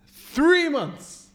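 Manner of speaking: THREE MONTHS high, loud and stretched; outraged disbelief. Retold or imitated. Repeats delay in outrage.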